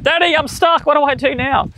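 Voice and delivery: put-on high-pitched little girl calling to Daddy; mock child's cry for help; daughter's voice. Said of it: "She's like".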